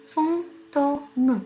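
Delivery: FANTÔME drawn out slowly in separated syllables. Shown as a demonstration. Teacher showing children the syllables to look for, fantôme syllable by syllable. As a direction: sound out "fantôme" slowly, piece by piece. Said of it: "trois syllabes qu'il faut chercher".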